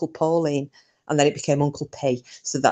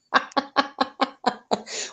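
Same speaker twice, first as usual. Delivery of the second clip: real high laugh trailing down; high laughter; 8 semitones higher, 5 dB quieter than usual. Real, not acted. Grandma laughing at her grandson's skeleton book, high laugh. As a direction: burst out laughing, high and falling.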